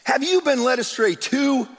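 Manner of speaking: high, loud scornful sneer; mock them for being fooled. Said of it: "the Pharisees mocked".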